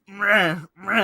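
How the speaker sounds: loud, high drill noise; imitated drill. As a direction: mimic two bursts of a power drill. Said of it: "He grabs a drill and just starts".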